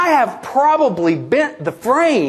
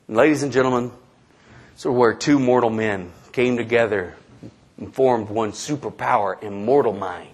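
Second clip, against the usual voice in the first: mock-solemn announcer; 10 semitones lower, 5 dB quieter than usual. Announcer to the crowd on two mortal men joining, mock-solemn. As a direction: intone it softly and gravely, far down in pitch.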